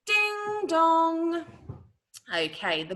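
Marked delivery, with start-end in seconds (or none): 0.1-1.4 high ringing two-note chime; long, sustained ding dong; clock chime imitation. Shown as a demonstration. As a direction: sing it out like a clock bell. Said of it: "it was a ding dong sound".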